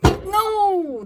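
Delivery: loud, high, drawn-out wail; theatrical howl of refusal; mock wail. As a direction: cry out in hammed-up dismay. Repeats acted wail of dismay.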